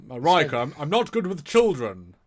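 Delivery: awkward captain. Retold or imitated. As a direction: imitate a stiff captain admitting discomfort. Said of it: "one of his few things was".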